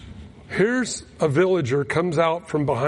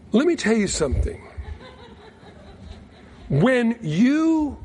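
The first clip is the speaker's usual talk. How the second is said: rising, higher preacher's build; preaching; 7 semitones higher than usual. Acted. Preacher warning the congregation about shared disease, rising and emphatic. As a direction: drive the lesson home to the congregation.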